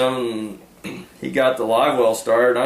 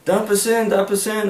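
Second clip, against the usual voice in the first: high urgent repeated call; high eager dump us in; retold urgent call; 11 semitones higher than usual. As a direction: hurry the launch along. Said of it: "He said".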